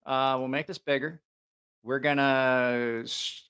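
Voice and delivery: usual speaking voice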